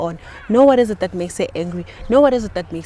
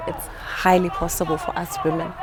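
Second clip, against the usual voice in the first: said slowly; 2 semitones lower, 8 dB quieter than usual. Real, not acted. Guest telling host women can go months, slowly. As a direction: spell it out slowly for him. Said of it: "I'm gonna say slowly".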